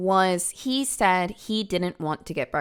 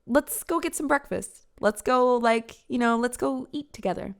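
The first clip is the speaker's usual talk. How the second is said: put-on higher, coaxing girlfriend voice; lightly voiced eager girlfriend; 5 semitones higher than usual. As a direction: sweet-talk him into breakfast, pitched up. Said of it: "she says".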